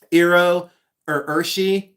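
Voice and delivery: "Iro or Urshi" said as EE-roh or UR-shee; own pronunciation. Shown as a demonstration. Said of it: "I pronounce it".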